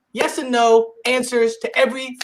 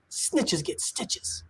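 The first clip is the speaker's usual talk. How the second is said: slow, lower whispered threat; 7 semitones lower, 13 dB quieter, much slower than usual. Retold or imitated. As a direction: hiss it like a little tough guy. Boy whispering to sister.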